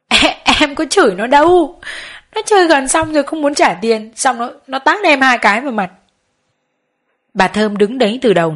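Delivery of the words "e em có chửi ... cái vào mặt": narrated tearful wail, higher pitched; crying loudly. A narrator's reading. Hà tearfully denies swearing at the customer, crying complaint. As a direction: protest in a high, acted crying voice. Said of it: "càng khóc to. Nó nói".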